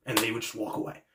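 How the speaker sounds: usual speaking voice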